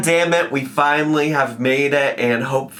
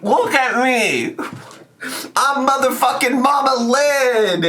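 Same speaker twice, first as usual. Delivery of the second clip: imitating Mama Lynn; 10 semitones higher than usual. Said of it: "She's like".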